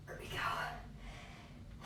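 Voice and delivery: breathless slow whisper; whispered effort. Real, not acted. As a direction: push it out in a strained whisper. Mid rolling exercise.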